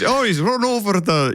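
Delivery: put-on abandoned stab at a Scottish accent; bad Scottish accent. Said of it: "I can't mock a Scottish accent".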